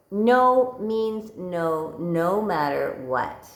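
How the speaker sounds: slow, stretched-out pronouncement; pointed quoting. Retold or imitated. Husband laughing at his rejected wife, slow and deliberate. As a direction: draw out each word deliberately. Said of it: "my husband says, like".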